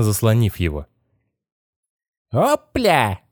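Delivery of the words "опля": very high squealing puppet-like voice; nasty squeal. A narrator's reading. Possessed boy popping out of the sarcophagus, nasty squeal. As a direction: squeal it out in a horrid high pitch. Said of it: "воскликнул Стэнли мерзким визгливым голосом".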